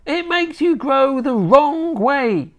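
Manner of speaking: high, slow scolding grandma; snapped retort. A narrator's reading. Grandma answering her grandson's protest, snappish. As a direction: snap it back in a high old woman's voice. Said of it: "she snapped".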